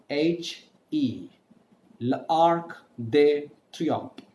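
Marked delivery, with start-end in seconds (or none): 2.0-4.2 L'Arc de Triomphe said very slowly; slow French. Shown as a demonstration. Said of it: "easy pronunciation".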